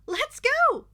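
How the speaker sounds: high, loud rallying cry; eager shout. Acted. Mina urging the group off, loud and high. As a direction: launch everyone into motion.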